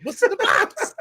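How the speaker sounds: high laughing guard line; mock guard. Friend laughing through a guard's question about the box. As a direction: squeak it out laughing. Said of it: "He's like".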